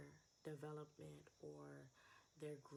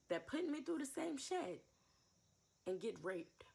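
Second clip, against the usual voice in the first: bitter angry outburst; 2 semitones higher, 8 dB louder than usual. Real, not acted. Cursing the mother who put her through trauma, furious. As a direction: spit the curse out through pain.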